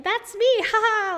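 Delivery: self-deprecating laugh imitation; mock laughing. Teasing cohost, mock laughing self-deprecation. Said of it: "laughing and saying".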